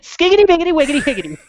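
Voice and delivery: high mock nonsense rap; Rappin' Rob. Playing Rappin' Rob for his co-host, high mock rap. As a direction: spit silly rhymes as a cheesy rapper. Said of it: "I'm Rappin' Rob".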